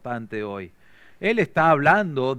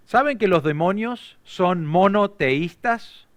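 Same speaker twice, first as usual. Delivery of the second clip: usual speaking voice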